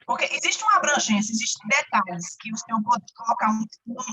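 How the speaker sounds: ordinary delivery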